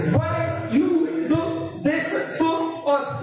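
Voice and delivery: slow, high scolding wail; Caribbean mother scolding. Retold or imitated. Preacher voicing a Caribbean mother scolding her lost son, slow and high. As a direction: drag it out like a fed-up mum. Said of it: "An Afro-Caribbean woman said".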